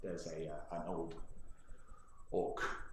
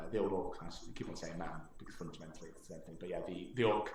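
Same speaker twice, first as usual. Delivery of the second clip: ordinary talk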